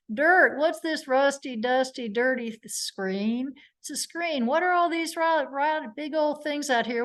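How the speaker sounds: walk the witness along in small, punchy steps; Vinny. Retold or imitated. Lawyer stepping a witness through photos, lightly imitated cross-examiner. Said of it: "the way Vinny did".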